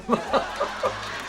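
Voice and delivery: soft laugh. Real, not acted. Host laughing at the quote.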